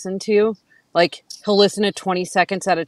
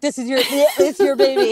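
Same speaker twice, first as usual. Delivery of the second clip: laughing, louder, gagging; laughing disgust; 5 semitones higher, 4 dB louder than usual. Retold or imitated. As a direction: blame him through the nausea. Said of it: "I'm like".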